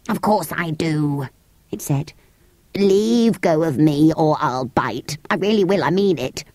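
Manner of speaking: irritable creature. Acted. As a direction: threaten peevishly, stretching the words. Psammead threatens to bite.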